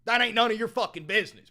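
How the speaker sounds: bellowing it at chat; shouted. Acted. Angrily yelling at chat to mind their business. Third repeat of the outburst.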